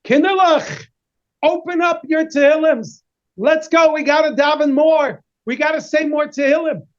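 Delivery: put-on rally the children to pray; rabbi urging children.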